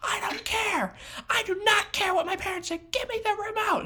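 put-on high, strained childish yell; screaming tantrum child. Role-playing kid refusing, high childish shouting tantrum. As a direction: shriek at him like an out-of-control kid. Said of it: "starts banging her head against the wall".

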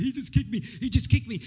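mock whining to the referee; whinging footballer. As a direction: moan to the ref like a crybaby. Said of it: "whinging and complaining to the referee".

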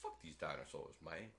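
very low, soft grumble; acted muttered cursing. Host voices man tossing talon away, muttered. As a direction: grumble it under your breath in character.

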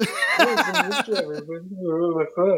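high, slowed-down celebrity impression with a laugh in it; Bill Murray. Teasing the co-host's Lyft tweet, slow mock impression. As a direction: drag it out in a hammed-up actor's voice. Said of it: "Bill Murray voice".

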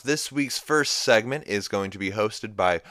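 loud, building announcer hype; announcer introduction. Acted. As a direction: stretch out the grand introduction, loudly. Hyped introduction of the segment host.

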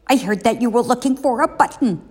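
high, fast, lightly voiced raccoon; a quick chatty little animal. A narrator's reading. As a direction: raccoon telling Toad about a button. Raccoon speaks.

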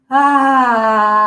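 loud open "ah" pushed out from the gut; loud release sigh. Shown as a demonstration. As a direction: let the breath go with a big sound. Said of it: "release with a sound from here".

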